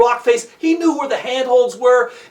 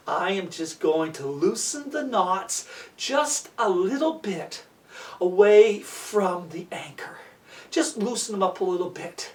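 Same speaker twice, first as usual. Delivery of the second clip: scheming; 4 semitones lower than usual. Acted. Mario plotting to loosen Walter's knots, scheming. Mario voices his sly idea.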